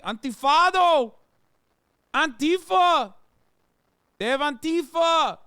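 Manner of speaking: mock Brazilian protester. Streamer mocks a Brazilian protester's Brazilian accent, shouting. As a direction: yell it like a Brazilian protester, hammed-up. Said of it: "I'm trying to do a Braz-, Brazil".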